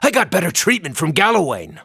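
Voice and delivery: normal talking voice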